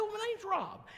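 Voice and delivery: high, sugary church-greeter welcome; gushing welcome. Retold or imitated. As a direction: lay the welcome on thick and high. Echoing her in high voice.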